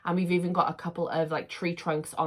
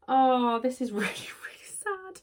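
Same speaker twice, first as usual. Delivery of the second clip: whispered tearful laugh; 6 semitones higher than usual. Real, not acted. Moved by t-shirt message.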